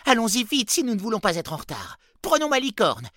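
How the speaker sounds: high, loud and hurried; urgent. A narrator's reading. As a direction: rush everyone out the door. Elf urges hurry in rain.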